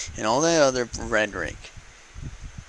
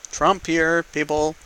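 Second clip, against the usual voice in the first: retold exasperated retort; 6 semitones higher, 5 dB louder than usual. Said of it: "and I'm like".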